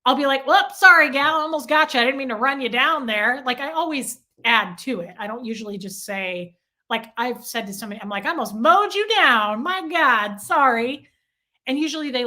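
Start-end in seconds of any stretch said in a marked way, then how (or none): 0.5-3.4 loud, higher-pitched folksy sorry; re-enacted hearty, chummy apology; jokey apology. Acts jovial apology to a stranger. 8.2-11.1 loud, higher-pitched jokey sorry; re-enacted boisterous apology; exaggerated apology. Acts joking apology to a stranger.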